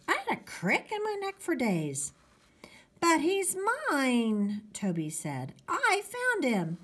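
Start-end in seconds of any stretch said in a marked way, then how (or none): 3.0-4.6 put-on high, drawn-out kid protest; protesting little boy. Toby protesting to his brother. 5.7-6.8 put-on high, slow kid insistence; insistent little boy. Toby insisting on his claim.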